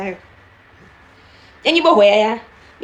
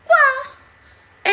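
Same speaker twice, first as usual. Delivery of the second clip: incredulous quoi. Real, not acted. Very high drawn-out question.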